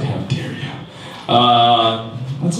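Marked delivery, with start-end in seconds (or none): 0.0-0.7 breathy whispered how dare you; mock hushed outrage. Horrified bigot whispering at the owner, mock hush. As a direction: gasp it out in a hush. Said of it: "He's like".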